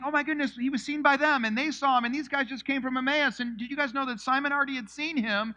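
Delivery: high, excited rush of gossip; breathless, can't stop talking; freaking out. Acted. Disciples sharing sightings in the upper room, excited. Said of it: "everyone's freaking out".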